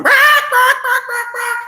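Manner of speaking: very high, slow yelping barks; silly high squawks fading off; distressed animal cries. Acted. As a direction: yelp like a frightened chicken. Upset chicken making noise.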